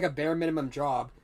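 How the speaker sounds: normal talking voice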